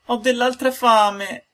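very slow, high whimper; slow high whiny complaint; whining. A narrator's reading. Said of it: "disse piagnucolando".

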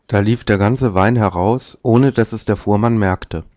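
usual speaking voice

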